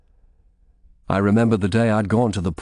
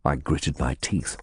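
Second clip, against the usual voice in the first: plain reading voice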